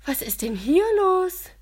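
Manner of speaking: deutlich höher, lauter und überrascht; erstaunt. A narrator's reading. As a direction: als platze man überrascht herein. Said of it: "fragt Iris erstaunt". (Iris kommt herein, laut erstaunte Frage)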